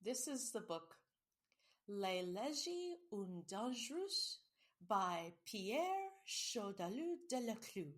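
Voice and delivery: slow, hammed-up French accent on the title and author. Host reading the French book title to her cohost, hammy French accent. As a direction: savor each French name in a mock French accent. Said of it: "Wow, that's a great French accent".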